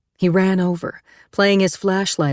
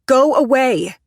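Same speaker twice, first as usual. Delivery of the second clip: raised, firm go away; firm refusal; 8 semitones higher, 5 dB louder, slower than usual. Acted. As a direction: push him off through the glass. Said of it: "she shook her head firmly".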